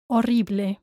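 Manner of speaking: Horrible as o-RREE-bleh; final E kept. Shown as a demonstration. Teacher models for learners, Horrible keeping its E. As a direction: keep the last E of Horrible. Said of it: "Don't drop that E".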